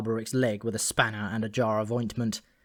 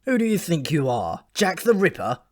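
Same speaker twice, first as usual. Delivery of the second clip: mocking scorn; 6 semitones higher, 4 dB louder than usual. Alberic mocks Malcolm.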